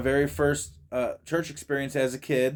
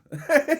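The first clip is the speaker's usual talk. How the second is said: laughing; 13 semitones higher, 4 dB louder than usual. Real, not acted. Laughing at name point.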